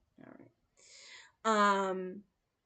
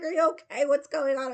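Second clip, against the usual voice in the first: imitating a concerned driver, louder and higher; higher, louder, anxious questions; worried driver; 7 semitones higher, 10 dB louder than usual. Uber driver asking the crying woman, higher and louder. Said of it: "He's like".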